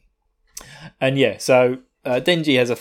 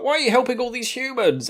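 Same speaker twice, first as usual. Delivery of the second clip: high-pitched baffled question; mock bafflement in a raised voice; really confused; 10 semitones higher than usual. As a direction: imitate a bewildered monster, pitched well up. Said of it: "like, really confused".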